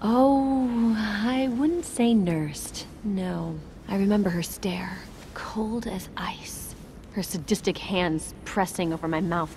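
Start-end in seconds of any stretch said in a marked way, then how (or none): 0.0-2.9 very slow, measured correction; pointedly drawn out. Acted. Patient correcting her tormentor's son about being nursed, pointedly drawn out. 5.3-6.7 slow, hushed whisper; chilled whisper. Acted. Victim recalling her tormentor's stare, chilled whisper. Acted chilling memory of abuser.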